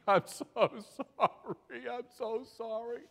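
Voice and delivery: high, drawn-out tearful wail; hammed-up crying apology, stretched out; mock weeping. Cab driver confesses to the nun, mock weeping. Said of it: "Weeping and crying".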